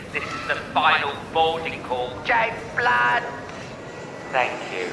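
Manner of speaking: tannoy boarding call voice; airport announcement. Pretend. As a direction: repeat it like airport PA. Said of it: "I repeat".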